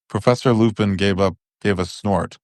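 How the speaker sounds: ordinary delivery, nothing marked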